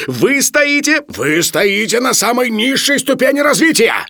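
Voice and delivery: very high, loud tirade; shouting over everyone. A narrator's reading. Professor outshouts both men to berate Sharikov, furious shouting. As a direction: outshout the room. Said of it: "перекричал Филипп Филиппович".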